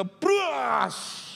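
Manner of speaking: high, explosive imitation of shattering glass; crashing glass noise. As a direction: burst out the sound of a smash. Imitates window shattering in story. (tiruan bunyi kaca pecah)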